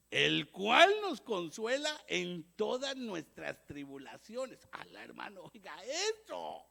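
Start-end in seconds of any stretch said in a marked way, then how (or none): 0.1-4.7 reading scripture aloud. Shown as a demonstration. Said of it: "Oiga lo que dice ahí".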